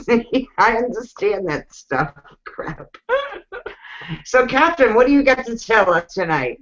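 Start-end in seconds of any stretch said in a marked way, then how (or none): 2.4-3.0 whispered curse. Real, not acted.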